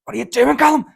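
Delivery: loud, very high-pitched retold yell; exasperated shout. Yelling at Callum for abandoning the long wait, exasperated. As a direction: shout it at a friend in acted disbelief.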